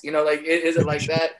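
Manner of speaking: ordinary talk, nothing marked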